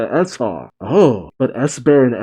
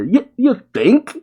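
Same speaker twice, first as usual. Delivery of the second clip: high-pitched, incredulous retort; sarcastic disbelief; 10 semitones higher than usual. Acted. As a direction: pitch shooting up into a mocking question. Sarcastic retort to Zoro's late admission.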